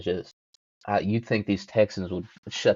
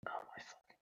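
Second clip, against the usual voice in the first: frustrated under-breath whisper; 24 dB quieter than usual. Real, not acted. Searching for the album, exasperated whisper. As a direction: breathe it out in annoyance.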